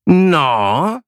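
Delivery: drawn-out, rising demand; pressing. A narrator's reading. Teacher pressing a silent pupil, insistent. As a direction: push for an answer with a swooping question. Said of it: "tlačila na mě".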